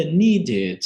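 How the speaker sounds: "needed" slow, with a clear "id" ending. Shown as a demonstration. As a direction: stretch "needed" to show the extra syllable. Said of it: "Pronuncio o E D como id". ("needed" lento, com "id" bem marcado)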